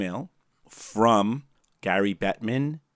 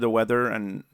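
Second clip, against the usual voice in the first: usual speaking voice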